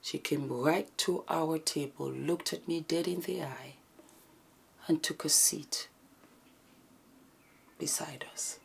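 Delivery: breathy whisper recounting a scary encounter, slow at times; hushed whispered storytelling. Acted. Telling viewers what happened to her and Karine, in a tense whisper.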